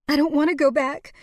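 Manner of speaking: high, loud, tearful protest; distressed. A narrator's reading. Woman refusing to leave dying lover, high distressed voice. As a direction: protest with desperate emotion.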